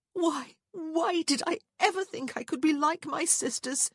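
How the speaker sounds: high, despairing plea; overwrought, pitched right up; pleading. A narrator's reading. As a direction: wail it as a plea to heaven. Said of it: "she pleaded skyward".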